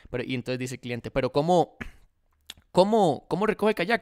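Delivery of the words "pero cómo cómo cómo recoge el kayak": rising, louder puzzled questions; mock bafflement over pickup; confused customer. Customer asks the seller how pickup works: mildly hammed-up confusion.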